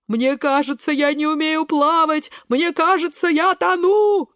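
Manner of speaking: high cries, rising to a long howl; panicked wail. A narrator's reading. Кабачок sure he is drowning, high panicked wail. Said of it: "вопит Кабачок".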